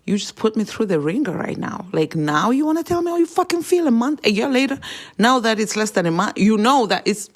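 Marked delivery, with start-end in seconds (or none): 1.9-7.3 higher and louder, building fury at the ex; real angry outburst. Real, not acted. Ranting to the camera about the ex, angry and loud. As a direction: let the anger spill over, voice climbing. Venting anger at ex.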